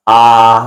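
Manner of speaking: आ with the mouth opened wide, breath from the navel; long open आ from the belly. Shown as a demonstration. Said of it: "आ में भी यहीं से आवाज".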